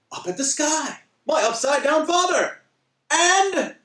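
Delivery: high-pitched, escalating comic panic; hammed-up tumbling commentary; mock frantic tumbling. Comic retelling of tumbling head over heels.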